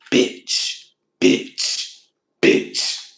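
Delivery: whispered, hissed "bitch" like the killer doll; Chucky hissing bitch. Retold or imitated. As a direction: spit "bitch" out as a harsh whisper, Chucky style. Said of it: "You know Chucky bitch syndrome?".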